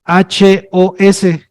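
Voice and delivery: letter by letter, very slowly; spelling out. Shown as a demonstration. Teacher quizzing the class on a word, spelling slowly. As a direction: spell it out with a gap after each letter. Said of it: "la palabra que se escribe".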